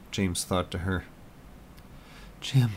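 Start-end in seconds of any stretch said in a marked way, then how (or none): 2.4-2.7 high, aching plea to a lost love; wife missing her husband, high and longing; tearful longing. Acted. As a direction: cry out for him, voice lifting. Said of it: "she replied".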